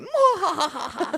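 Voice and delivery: high, drawn-out mock-villain "mwah-ha"; hammed-up villain laugh; evil laugh. Shown as a demonstration. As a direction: show off a silly evil cackle. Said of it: "That evil laugh".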